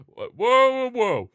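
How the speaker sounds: high, loud string of whoas; loud, high-pitched double take; startled objection. Real, not acted. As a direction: call a sudden halt in disbelief. Startled by the graphics' claim.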